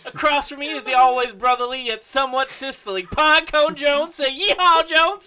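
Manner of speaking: high hollering country host; yeehaw cowboy voice. Pretend. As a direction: holler it like a rodeo announcer. Hammed-up cowboy podcast intro bit.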